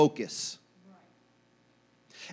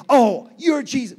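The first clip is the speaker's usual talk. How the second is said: louder, higher burst of recognition; mock exclamation; 5 semitones higher, 6 dB louder than usual. People in need spotting who helps them, mock exclamation. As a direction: exclaim it as a sudden discovery.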